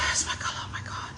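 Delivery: breathless hushed whisper of awe; awed whisper. Real, not acted. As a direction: whisper it, too moved for voice. Awed murmur at the colour.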